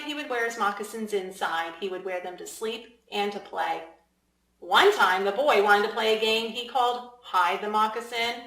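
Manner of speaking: ordinary delivery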